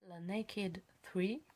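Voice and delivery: slow, halting English accent on "Naked three"; poor English accent. Real, not acted. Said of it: "mon accent anglais est pitoyable".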